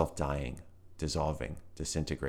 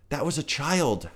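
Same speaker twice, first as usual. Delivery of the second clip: high anguished howl; 10 semitones higher, 4 dB louder than usual. A narrator's reading. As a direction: cry it out as he's dragged away. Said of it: "howled Volt".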